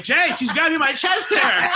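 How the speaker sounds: high, loud, laughing mock alarm; laughing complaint. Complaining to Jay about the kid, laughing hard. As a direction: plead through laughter.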